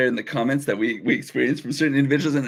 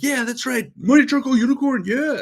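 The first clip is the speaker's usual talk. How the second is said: proud; 12 semitones higher, 4 dB louder than usual. Retold or imitated. Owning the silly nickname proudly.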